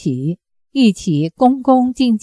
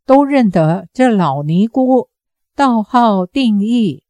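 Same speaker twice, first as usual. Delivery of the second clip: ordinary delivery, nothing marked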